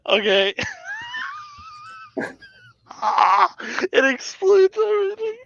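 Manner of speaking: laughing so hard the voice cracks high; near-tearful laughter. Real, not acted. Laughing fit at own mistake.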